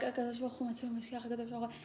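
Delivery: softly retold prayer with eyes closed; quiet prayer. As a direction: pray to yourself under your breath. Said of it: "I close my eyes. I'm like".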